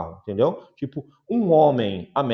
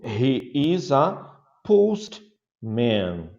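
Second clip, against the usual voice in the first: slow model answer; much slower than usual. Shown as a demonstration. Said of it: "slow model answer".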